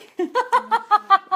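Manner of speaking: high burst of laughter; loud laughter. Real, not acted. Laughing hard after being told her accent is wrong. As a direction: cracks up at the accent comment. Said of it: "Don't know what accent that was".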